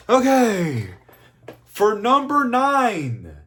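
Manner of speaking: loud, high, drawn-out countdown announcement; hyped announcer. Real, not acted. Reviewer announcing number nine on the list, hyped announcer. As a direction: stretch out each phrase like a big reveal.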